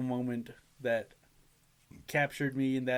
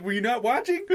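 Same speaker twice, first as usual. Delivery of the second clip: high, loud incredulous question; laughing, exasperated disbelief; retold indignation; 9 semitones higher, 6 dB louder than usual. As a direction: demand it in mock outrage, rising at the end. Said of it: "I remember being heated".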